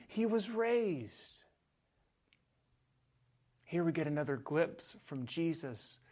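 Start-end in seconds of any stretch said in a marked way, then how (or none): 0.1-1.4 high, slow proclamation; slow emphasis. Shown as a demonstration. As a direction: lift the words up slowly. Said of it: "literally saying".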